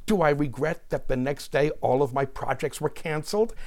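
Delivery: mounting volley of rhetorical questions; worked-up quoted rant, each question pushed upward; Roseanne Barr. Acted. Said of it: "Roseanne replied".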